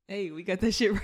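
ordinary delivery

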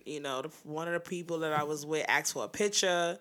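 usual speaking voice

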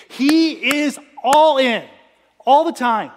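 loud, slow, ringing proclamation; sermon climax, pounding out each word; preaching at full force. Real, not acted. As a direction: drive the line home to the room.

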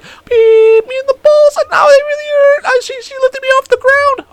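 hammed-up squealing complaint from the floor; highest-pitched voice. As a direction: wail it way up high, hurting. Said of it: "I said the same shit".

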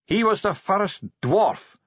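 high, slow dwarf recitation; Bashfulson translating. A narrator's reading. Dwarf translates old scripture, high and solemn. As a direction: intone it high and stretched. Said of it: "Bashfulson translated".

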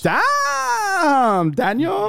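playful hollered praise; mock amazed shout. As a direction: ham up the amazement.

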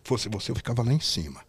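imitated low, quiet warning; lightly voiced caution, kept low and soft; Valentino's warning. Valentino warns the new guy the show is going badly, low and quiet. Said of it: "O Valentino falou".

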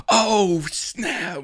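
very high, loud hammed-up exclamation; mock overwhelmed. Pretending to review the movie just seen, mock-overwhelmed cry. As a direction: wail it like an awestruck moviegoer. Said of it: "We're, like, crying".

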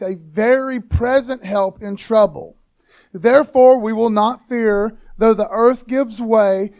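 loud, slow, emphatic Bible reading; scripture reading. Acted. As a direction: proclaim the psalm to the congregation, drawing out key words. Reads the psalm aloud to church.

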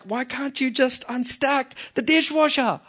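high-pitched exasperated plea, imitating a wife; frustrated wife. Wife to husband about the unstacked dishwasher, frustrated. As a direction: act out a fed-up wife's nagging question. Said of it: "is so frustrated and she's like".

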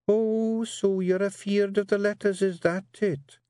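high, sly Scots teasing; artful Jeanie. Acted. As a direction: bait him with mock surprise. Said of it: "said Jeanie artfully".

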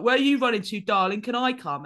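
a lightly voiced taunting bloke calling out darling; mock catcall. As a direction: tease like a bloke shouting after a runner. Mimicking a second catcall.

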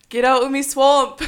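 loud, high mock-Scottish shout; over-the-top Scottish ogre yell; Scottish accent. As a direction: bellow it in a hammed-up Scottish accent. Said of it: "Scottish accent, go".

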